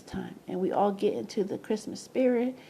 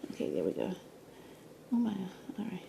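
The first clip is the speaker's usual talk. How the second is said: whisper; 4 semitones lower, 11 dB quieter, faster than usual. Real, not acted. Fixing his camera.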